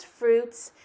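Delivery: ordinary talk, nothing marked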